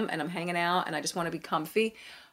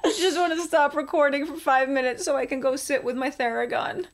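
high, laughing whine of pain; biting down on pain; 7 semitones higher than usual. Pretend. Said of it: "I'm in so much pain right now".

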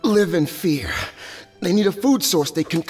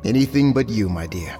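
tender; 7 semitones lower than usual. Acted. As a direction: answer her with devotion. Loving reply to wife.

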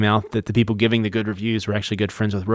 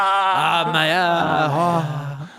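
higher, sighing mock French; French accent; 6 semitones higher than usual. Co-host calling out a good friend, swooning French accent. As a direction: swoon over it in hammy French. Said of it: "So French".

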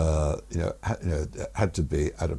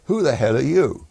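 mock rude bark, higher and louder; rude demand; 7 semitones higher, 7 dB louder than usual. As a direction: snap it at the stranger like an annoyed commander. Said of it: "he very rudely said".

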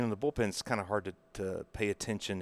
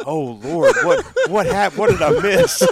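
laughing through a mock panicked thought, louder; half-laughing "what did I miss"; 12 semitones higher, 12 dB louder than usual.

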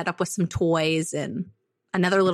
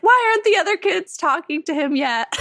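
sad worry; 9 semitones higher, 3 dB louder than usual. Retold or imitated. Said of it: "I just felt sad".

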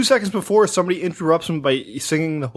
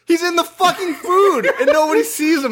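exasperated disbelief; 11 semitones higher than usual. Real, not acted. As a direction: cry out at how absurd it is, drawing words out. Exasperated rant about absurd film scene.

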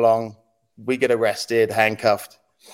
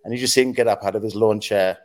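normal talking voice

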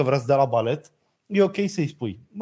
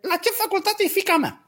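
a light mock of the form teacher's voice; mimicking the teacher's tone. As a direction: imitate her prying phone voice, lightly. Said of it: "Dar exact pe tonul ăsta". (imitând ușor tonul dirigintei)

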